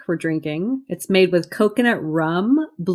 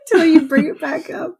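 squealing laugh; 7 semitones higher, faster than usual. Real, not acted. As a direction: laugh it out in embarrassment. [name] laughing embarrassed.

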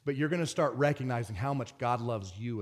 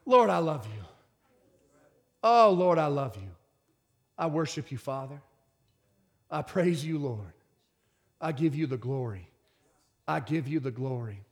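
worship prayer. Acted.

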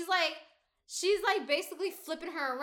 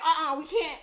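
excited; 3 semitones higher, 8 dB louder than usual. Retold or imitated. Retold panicked refusal.

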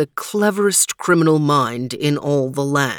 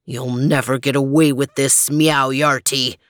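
low, firm defiant growl; 5 semitones lower, 3 dB louder than usual. Acted. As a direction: stand up to the villain. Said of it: "growled Overton".

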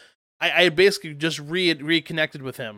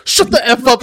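mock snap; 7 semitones higher, 9 dB louder than usual. Friend snapping back in story.

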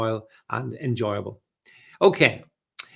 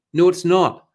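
No punched higher and louder, falling; firm denial; 5 semitones higher, 7 dB louder than usual. Real, not acted. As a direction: slam the door on the claim. Rebutting the moon claim.

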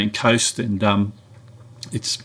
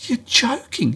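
much higher, disbelieving You're joking; incredulous; 12 semitones higher than usual. Retold or imitated. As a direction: squeak it out in disbelief. Disbelief at hitting rocks.

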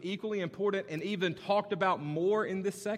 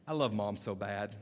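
put-on little boy voice; 6 semitones lower than usual. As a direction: imitate a toddler's stubborn reply. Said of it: "his response was every time".

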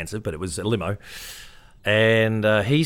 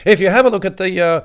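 nervous; 8 semitones higher, 9 dB louder than usual. Retold or imitated. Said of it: "I was so nervous".

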